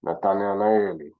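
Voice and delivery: "Nathanaeli" drawn out slowly, every syllable; slow native "Nathanaeli"; slow careful name. Shown as a demonstration. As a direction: spell "Nathanaeli" out with the voice. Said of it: "Didn't I just say that?".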